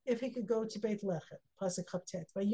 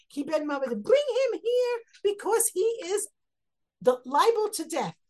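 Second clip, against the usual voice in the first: much higher, louder raging king; furious shout; 9 semitones higher, 7 dB louder than usual. Retold or imitated. Shaul demanding David be brought to die, raging shout. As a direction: roar it like an enraged king. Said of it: "Shaul gets very angry".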